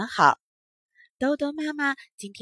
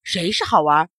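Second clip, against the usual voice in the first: low-pitched, lightly voiced old monkey; angry old monkey; 6 semitones lower, 4 dB louder than usual. A narrator's reading. Old monkey questioning the children, mildly angry. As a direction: demand it in a lowered voice. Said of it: "老猴子也生气了".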